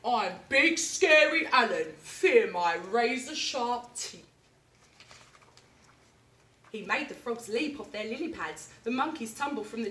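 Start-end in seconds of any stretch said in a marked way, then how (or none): 0.0-4.2 loud, slow boastful monster voice; big scary alligator. Acted. Alan boasts to the jungle animals, loud slow menace. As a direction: boom it out slowly to frighten. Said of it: "he said things like".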